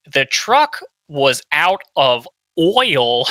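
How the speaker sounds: slow, laboured imitation Northern accent; attempted Northern accent. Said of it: "in a Northern accent, and he's like".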